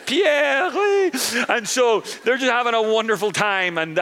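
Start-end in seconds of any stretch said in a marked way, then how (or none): 0.1-1.1 squeaky high mock French call of Pierre; mock French cheer. French soldiers calling to each other, high mock French. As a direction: yelp it up high like a giddy Frenchman.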